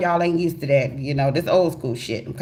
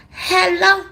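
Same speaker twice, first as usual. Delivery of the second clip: much higher, louder hello; high phone hello; 14 semitones higher, 5 dB louder than usual. Retold or imitated. Answering the mystery caller, high and loud. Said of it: "I'm like".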